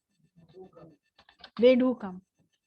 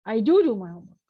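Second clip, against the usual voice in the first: first "do" punched louder, then falling away; stress on "do"; 3 semitones lower, 4 dB louder than usual. Acted. Student rebuts blame about homework, first "do" stressed. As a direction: insist on the first "do". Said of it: "highlight your tone on the word do".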